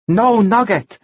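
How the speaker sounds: high-pitched halting translator; Arsuk, translating. A narrator's reading.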